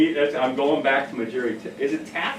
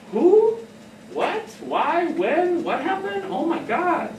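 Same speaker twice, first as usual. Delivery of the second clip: mock shocked questions; 12 semitones higher, slower than usual. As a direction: pile on the questions in mock alarm. Mock rapid-fire questioning.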